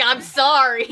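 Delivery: loud, high, unrepentant protest; mock apology. Joker answering fed-up family, loud mock apology. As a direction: protest loudly that you can't help it.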